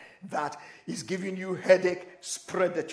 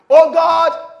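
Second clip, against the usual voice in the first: loud, high cry to God; shouting; 10 semitones higher, 17 dB louder than usual. Shown as a demonstration. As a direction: belt out a desperate prayer call. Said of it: "I'm shouting like an African man".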